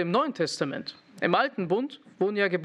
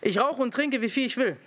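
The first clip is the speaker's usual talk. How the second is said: put-on defiant teen; 4 semitones higher than usual. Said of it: "Georgi sagt".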